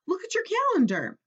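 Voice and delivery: high, eager urging; eager Frog. A narrator's reading. Frog urging Toad to check the calendar, eager and high. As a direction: point him to it gleefully. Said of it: "said Frog".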